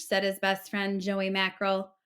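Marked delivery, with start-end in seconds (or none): none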